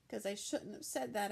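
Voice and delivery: usual speaking voice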